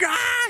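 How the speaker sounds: a high, mock battle scream; imitated scream. Retelling Darby's rage at the fleeing Death Riders, a high mock yell. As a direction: yelp it up high like a crazed wrestler. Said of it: "He's, like, screaming".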